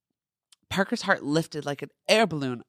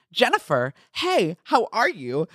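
cheerful greeting; 5 semitones higher, 4 dB louder, slower than usual. A narrator's reading. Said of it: "then cheered".

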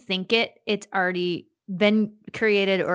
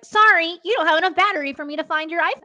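high, fast gadget voice; smartwatch voice; 8 semitones higher, 3 dB louder, faster than usual. Retold or imitated. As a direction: voice the smartwatch's excuse. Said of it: "and it was like".

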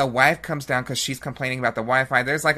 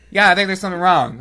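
louder, fast mock shout; mock loud dad; 5 semitones higher, 7 dB louder, faster than usual. Husband answering his wife, mock loud. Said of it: "and he's like".

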